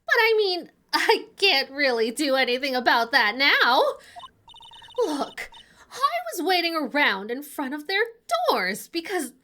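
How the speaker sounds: flustered witness. Acted. Witness explains waiting around by the doors, flustered. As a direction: protest and hedge as a cornered witness.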